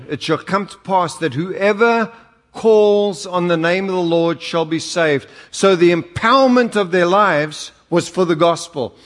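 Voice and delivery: preaching. Acted. Reads scripture in preaching voice.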